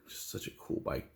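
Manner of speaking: soft breathy whisper. Real, not acted. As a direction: whisper it to yourself. Repeats the line, now whispered.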